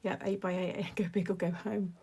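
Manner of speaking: ordinary talk, nothing marked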